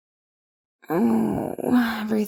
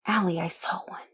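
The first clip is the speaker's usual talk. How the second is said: awed whisper; 3 semitones lower, 6 dB quieter, faster than usual. Acted. Shocked realisation.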